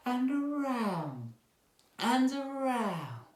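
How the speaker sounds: high, sweet, drawn-out voice; gentle voice. Shown as a demonstration. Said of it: "a very nice gentle voice".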